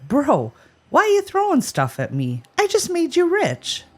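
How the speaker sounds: high, mildly indignant donkey; confused donkey. Acted. Host voicing the donkey to Jim, baffled high protest. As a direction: protest in a lightly voiced donkey, pitch climbing. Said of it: "the donkey was fine".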